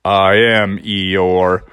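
low, slow, mopey donkey voice; gloomy Eeyore. Acted. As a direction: sigh it out like Eeyore. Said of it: "And Eeyore was like".

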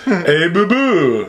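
slow cartoon bear greeting; lightly voiced bear, drawing out the name; laughing attempt at a cartoon bear call; Yogi Bear. Playing Yogi calling Boo Boo.

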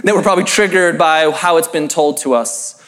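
ordinary talk

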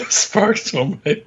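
giggling through the words; high laughing repeat. Real, not acted. Laughing, repeating partner's phrase.

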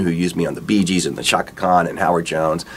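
ordinary talk, nothing marked

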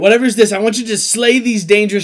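hammed-up damsel pleading with boyfriend; damsel in distress. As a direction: play the helpless girlfriend begging her knight. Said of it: "Yeah, like".

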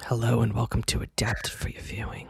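soft close-mic whisper; breathy radio whisper into the mic; NPR whisper. Pretend. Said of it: "more serious than that".

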